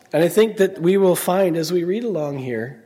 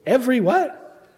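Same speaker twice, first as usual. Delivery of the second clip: ordinary talk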